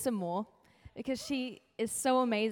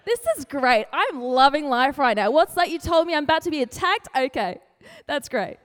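mock cheerful sarcasm; 2 semitones higher, 8 dB louder than usual. Sarcastic mock reaction to disaster.

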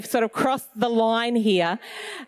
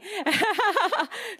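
light laugh; 10 semitones higher than usual. Real, not acted. Laughs after her point.

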